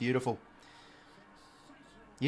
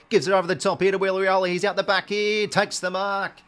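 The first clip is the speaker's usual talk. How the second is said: higher, louder and fast as the play breaks open; excited call; 6 semitones higher, 7 dB louder than usual. Real, not acted. Commentator calling a breakout to goal, excited and rising. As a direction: lift the call as he streaks clear.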